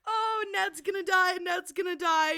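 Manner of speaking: loud high alarm; alarmed cry. Retold or imitated. Reader predicting a death, loud alarm. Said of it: "when I was like".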